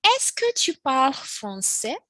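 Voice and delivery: slow teacher demo; slow model question. Shown as a demonstration. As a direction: read it slowly for learners. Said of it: "Next we have".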